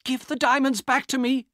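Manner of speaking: high, loud desperate demand; cried out. Acted. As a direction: scream at him to give them back. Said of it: "Elena cried".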